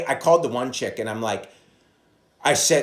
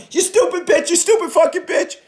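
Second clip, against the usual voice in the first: much higher, loud, shrill-free screaming insult; mock angry caller; 8 dB louder than usual. Angry callers yelling at the receptionist, high and loud. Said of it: "people calling in going".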